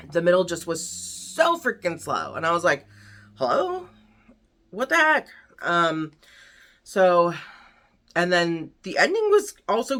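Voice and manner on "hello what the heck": high-pitched, questioning mock bewilderment; mock incredulous. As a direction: act out a baffled, impatient reader. Retold impatient reaction to slow book.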